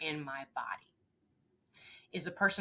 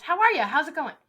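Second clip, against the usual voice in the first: much higher and louder cheerful hello; upbeat greeting; 10 semitones higher, 11 dB louder than usual. Retold or imitated. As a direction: greet the group with big energy. Coach greets group.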